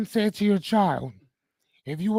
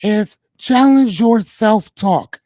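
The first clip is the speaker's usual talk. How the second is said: slow and emphatic; 9 dB louder, much slower than usual. Real, not acted. Host stresses the second tip.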